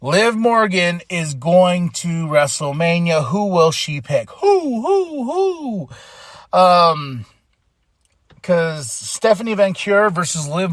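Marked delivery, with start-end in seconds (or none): 4.3-6.6 high, giggly hoots of who; mock owl hoot with a laugh; playful hooting. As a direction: toss out a teasing, high-pitched who who who. Playful hooting after a pick question.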